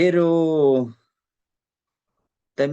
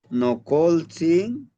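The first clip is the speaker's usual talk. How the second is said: slow prayerful; 3 semitones higher, 3 dB louder, much slower than usual. Shown as a demonstration. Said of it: "Es parte de una plegaria".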